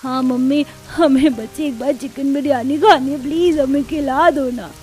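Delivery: lightly voiced wheedling child; pleading child. Child begging mother for biryani, pleading. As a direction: beg with a hint of childlike coaxing.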